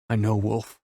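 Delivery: usual speaking voice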